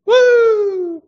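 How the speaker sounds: high, loud whoop of joy; excited whoop. Pretend. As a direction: let out a big cheer. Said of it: "show some emotion".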